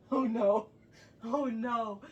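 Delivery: usual speaking voice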